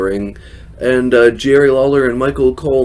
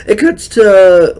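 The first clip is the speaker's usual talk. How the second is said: voice crack; 8 semitones higher, 6 dB louder, slower than usual. Real, not acted. Recapping a wrestling segment, voice cracks high. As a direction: voice cracks up high and loud mid-phrase. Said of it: "well, that was a real bad, uh, voice crack".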